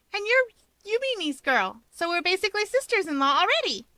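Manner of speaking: put-on high bright girl voice; young woman voice. A narrator's reading. Rika's voice, high.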